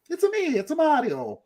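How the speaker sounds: high-pitched cartoon Italian accent; playful squeaky Italian-accented impression; Mario. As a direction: imitate the video game plumber, way up high. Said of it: "liked to have heard Chris Pratt do".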